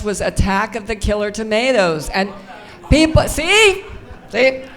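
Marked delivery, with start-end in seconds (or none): 2.9-4.6 excited laughing call-out. Real, not acted. Audience laughs at her pick.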